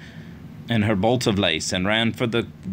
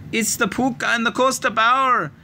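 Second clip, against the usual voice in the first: put-on screeching girl; 11 semitones higher than usual. A narrator's reading. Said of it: "Ailish screeched".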